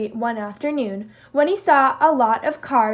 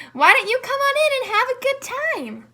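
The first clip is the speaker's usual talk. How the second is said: much higher, friendly coaxing tone; smiling invitation; 12 semitones higher than usual. Acted. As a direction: Zebra inviting Jackal in with a smile, friendly and higher. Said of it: "smiled Zebra".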